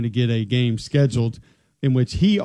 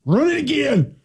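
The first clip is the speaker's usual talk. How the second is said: much higher hollering coach with a Southern twang; O-line coach impression; 13 semitones higher than usual. Co-host mocks a line coach at practice, hollered Southern. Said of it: "impersonate offensive line coaches".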